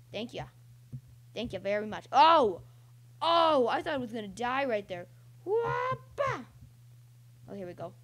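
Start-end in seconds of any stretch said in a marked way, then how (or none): 2.1-2.6 loud startled "oh"; startled yell. Real, not acted. Player thought he'd die right there, startled yell. Nearly died in game. 5.4-6.5 high, loud two-beat whoop. Real, not acted. Player dodging in the game, high whoop.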